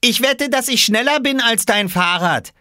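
put-on very high, loud boy's voice; high-pitched childish challenge; child bragging. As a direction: boast like an excited kid.

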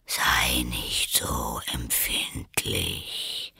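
low, soft whisper; caterpillar. A narrator's reading. The caterpillar coolly contradicts Alice in a whisper. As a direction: dismiss her in a low whisper.

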